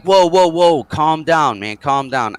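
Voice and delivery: mock alarmed plea, high then easing; urgent placating. Teller to the yelling bar owner, acted urgent placating. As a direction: talk down an angry man, starting loud.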